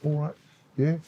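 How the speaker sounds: casual London mate greeting, lightly imitated; London accent. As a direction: toss it off like a London schoolboy. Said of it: "Ended up talking like that".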